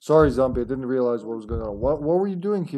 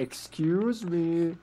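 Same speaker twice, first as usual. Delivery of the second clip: mock polite; 4 semitones higher, 5 dB quieter, much slower than usual. Mimicking the game character again.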